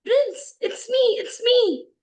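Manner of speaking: high, loud joyful cry; excited greeting. Acted. Princess revealing herself to her prince, excited. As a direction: call out eagerly to be recognised.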